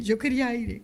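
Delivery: ordinary talk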